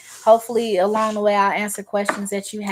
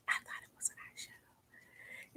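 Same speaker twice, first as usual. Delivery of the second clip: sheepish whisper, a little slow; embarrassed whisper; 23 dB quieter than usual. Retold or imitated. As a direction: confess it under your breath. Embarrassed young self answering.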